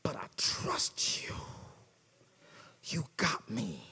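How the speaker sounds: soft, slow, hushed prayer fading to a whisper; quiet prayer. Retold or imitated. Believer quietly praying to the Lord, hushed and slow. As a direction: pray it softly, surrendering. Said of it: "And you gotta say".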